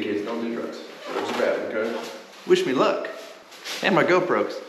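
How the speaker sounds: ordinary delivery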